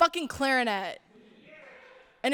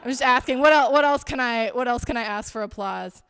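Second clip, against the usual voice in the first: normal talking voice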